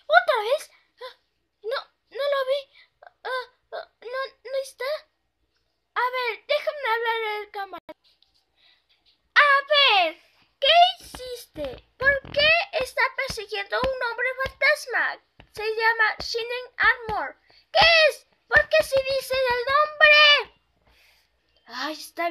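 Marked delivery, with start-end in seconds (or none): 0.1-7.9 high, whimpering pony voice; scared and near tears, high; whimpering fright. Acted. The pony hears the ghost again and whimpers, high and frightened. 9.3-20.6 high, loud, demanding pony voice; upset shouting pony. Acted. The pony shouts at the ghost demanding why it chases her, high and loud.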